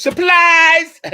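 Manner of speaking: high, very loud mock surprise yell; hammed-up high shout in a joke; shouted punchline. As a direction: leap out and holler the punchline. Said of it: "Chinese guy jumps out and goes".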